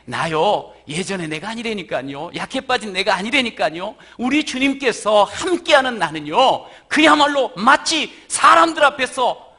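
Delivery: loud, high, fervent preaching; full-force preaching. Real, not acted. Pastor stirring the congregation, loud fervent preaching. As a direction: preach it out with rising power.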